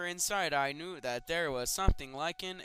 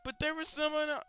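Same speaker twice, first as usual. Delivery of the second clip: pleading shout; 10 semitones higher, 3 dB louder than usual. Acted. Child pleading with mom.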